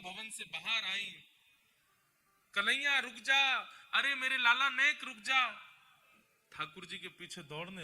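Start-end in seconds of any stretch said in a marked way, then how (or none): 2.5-5.5 higher, louder pleading call to stop; calling after him. Acted. Mother calls after Krishna's chariot, begging him to stop. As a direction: cry out desperately after the departing son.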